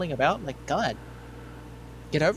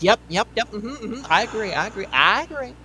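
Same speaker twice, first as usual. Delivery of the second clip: higher, bobbing mock nods of agreement; mock eager agreement; 6 semitones higher than usual. Mocking Kamala Harris nodding behind the president, light mock agreement. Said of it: "She's like".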